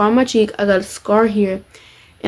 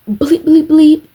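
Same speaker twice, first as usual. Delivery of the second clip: high loud bleep bleep bleep; loud bleeped cursing; 6 semitones higher, 4 dB louder, slower than usual. Retold or imitated. Boy yelling curses at the group, bleeped loud. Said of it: "he said it really loud".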